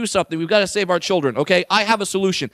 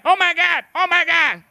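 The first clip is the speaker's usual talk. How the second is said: high mock gushing; raised-pitch fan frenzy; freaking out; 5 semitones higher than usual. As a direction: imitate an overexcited stranger. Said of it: "just starts freaking out".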